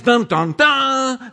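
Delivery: sung dun dun dun rising high; dramatic sting. Pretend. Preacher imitating the orchestra with a mock drum sting. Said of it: "the orchestra plays".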